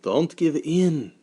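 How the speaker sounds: a father urging a friend on; encouraging. Acted. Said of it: "my father tried to encourage him".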